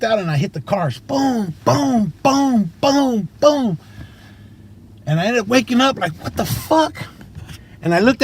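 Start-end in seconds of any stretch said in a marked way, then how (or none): 1.0-3.8 higher-pitched mimicked boom of each impact; crash sounds. Narrating the crash into six cars, mock impact booms. 6.2-7.1 much higher, bewildered exclamation; startled wake-up. Retold or imitated. Waking up behind the wheel after the crash, startled.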